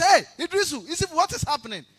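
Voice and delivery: high, loud baffled shout; calling out. Acted. Imam calling his students, high alarmed shout. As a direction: yell for help across the mosque. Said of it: "And then he called his students".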